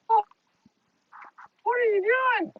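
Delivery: high drawn-out pet talk; cooing at a bird. Real, not acted. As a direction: stretch it out sweetly for the bird. Cooing to a pigeon.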